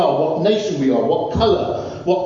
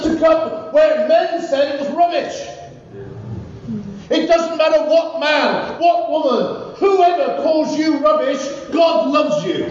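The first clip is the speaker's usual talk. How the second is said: rousing preaching; 6 semitones higher than usual. Real, not acted. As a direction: preach it out full force.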